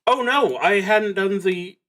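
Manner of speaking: a high, loud "oh no" as the mistake dawns; sudden alarm. Real, not acted. Player noticing a miscount mid-game, startled and loud. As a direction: caught off guard, pitch jumping up. Realizes he skipped a step.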